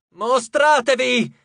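high, loud, drawn-out demand to the sky; shouted command. A narrator's reading. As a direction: cry out to unseen gods. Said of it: "gridò la ragazza al cielo". (grido imperioso)

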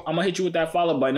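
usual speaking voice

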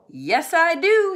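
put-on high, loud, indignant boy; defiant kid. A narrator's reading. Nate shouting back at Ben, loud and defiant.

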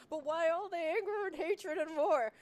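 high, loud mock wail; hammed-up despairing cry; exasperated plea. Retelling her second day to the co-host: high mock wail. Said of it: "I was like".

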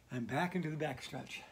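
usual speaking voice

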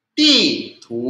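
Ti falling from high, tu rising; fourth then second tone. Shown as a demonstration. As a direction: drop "Ti" sharply from high, slide "tu" upward. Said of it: "Empat dan dua".

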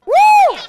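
very loud, high whoop; grito de porra. Pretend. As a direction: let out one big fan "woo". Host joins the cheering. (alarido agudo de celebración)

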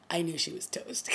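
hushed, breathy whisper with a laugh; a knowing whisper, laughing under the breath. Real, not acted. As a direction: whisper it like a secret you can't keep. Excited secret about the killer.

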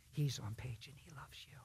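a soft whisper of comfort; whispered reassurance. Acted. Preacher whispering to a man still in pain, tender. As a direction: lean in and whisper it gently.

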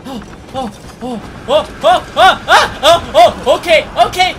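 rising gasping alarm; panicked oh-ohs. Real, not acted. Monster chasing, rising panic.

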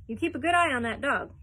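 loud commanding duke; the Duke shouting at Heinz; shouted. A narrator's reading. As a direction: shout the order at him. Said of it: "the Duke shouted".

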